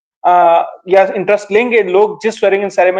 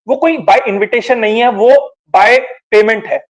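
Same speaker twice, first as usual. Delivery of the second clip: drawn-out stress on "by payment", each word spaced and weighted; slow emphatic contrast; slower than usual. Real, not acted. Panelist exposing paid VIP seats, slow and emphatic. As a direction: land hard and slow on "by payment" against "by invitation".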